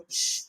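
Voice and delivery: "sh" as a soft voiceless hush; isolated consonant. Shown as a demonstration. Teacher explaining the symbol replacing S H, bare hushed "sh". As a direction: make only the hushing sound "sh". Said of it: "instead of S H".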